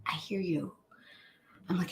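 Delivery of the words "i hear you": whispered, soothing; soft reassurance. Retold or imitated. Doctor calms her patient with a whispered reassurance. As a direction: settle her down gently.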